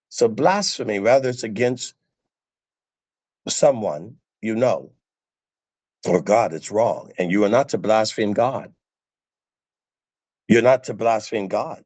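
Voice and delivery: ordinary delivery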